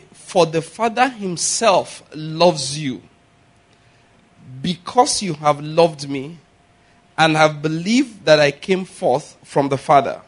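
slow, weighty reading of the verse; scripture read aloud slowly; solemn scripture reading. Acted. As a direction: read it slowly, let each phrase land.

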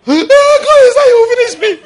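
very high, loud mock cry to God; hammed-up wailing prayer. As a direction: wail it like desperate pleading on your knees. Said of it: "if I go home and kneel down".

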